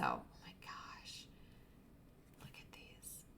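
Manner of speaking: hushed awed whisper; soft delighted whisper. Real, not acted. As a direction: whisper it like a secret. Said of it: "I don't know why I'm whispering".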